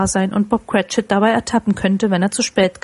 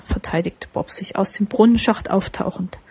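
ordinary delivery, nothing marked

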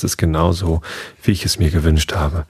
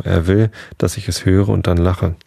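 usual speaking voice

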